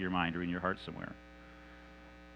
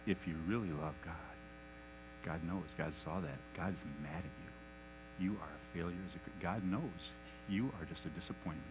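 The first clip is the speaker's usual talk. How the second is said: sly lying whisper; 8 semitones lower, 16 dB quieter than usual. Acted. Satan the liar softly telling Mike God is disappointed. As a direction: pass the lie off gently as God's word.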